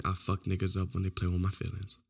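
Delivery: hushed, unvoiced quote; breathy retold whisper. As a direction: whisper her line as a secret. Quoting her, whispered.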